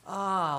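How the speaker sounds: high, open sighing "ah"; long "ah" of release, falling in pitch; demonstrated body release. Shown as a demonstration. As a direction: let out a loud stretching sigh. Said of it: "express itself physically however it wants".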